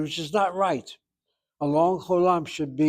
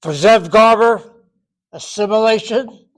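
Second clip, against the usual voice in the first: a sudden loud joking outburst with laughter; 5 semitones higher, 9 dB louder than usual. Real, not acted. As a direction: burst out loud, laughing at the word.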